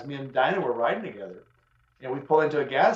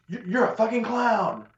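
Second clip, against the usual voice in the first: higher, slow sneering insult; mock angry driver, higher and drawn out; 8 semitones higher, slower than usual. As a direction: spit the insult out slowly, pitched up. Rude driver insults him.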